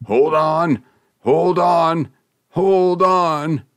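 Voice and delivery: loud, high straining cry from below; gasped shouting. A narrator's reading. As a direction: shout it with the last of your breath. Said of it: "a voice gasped out from below".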